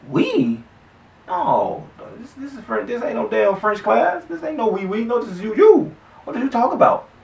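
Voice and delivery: high-pitched mock disbelief; retelling his own outraged reaction to the friend; incredulous. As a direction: squeak out how absurd it sounds. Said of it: "So I'm".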